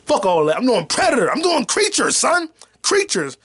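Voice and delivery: high-pitched, fired-up rant; hyped boasting. Real, not acted. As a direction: brag excitedly, pitch jumping way up. Hyped boast about doing creatures.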